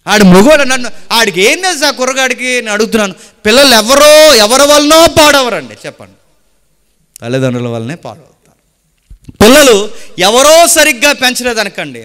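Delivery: preaching at full force. Acted. Preacher warning parents their children copy them, forcefully.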